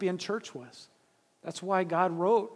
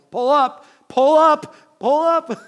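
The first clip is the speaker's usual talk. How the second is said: high, loud repeated warning shout; urgent playful alarm, each call punched out; mock cockpit alarm; 10 semitones higher, 14 dB louder than usual. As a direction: blare it like a plane's warning system. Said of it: "Warning, warning, you know".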